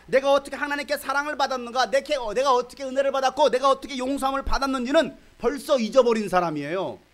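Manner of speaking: loud, high impassioned preaching; preaching at full force. Real, not acted. Pastor rebuking the congregation, loud and high. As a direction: drive it home to the church.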